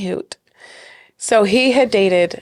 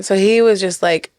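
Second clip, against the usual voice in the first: ordinary talk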